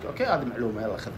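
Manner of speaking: muttered viewer reaction; viewer aside. Pretend. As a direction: toss it off quietly as the viewer.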